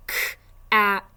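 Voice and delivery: K-at said loud with the K split off; sounding out. Shown as a demonstration. Teacher sounding out cat for parents, loud and split. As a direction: punch the K, then "at". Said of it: "Let's sound it out".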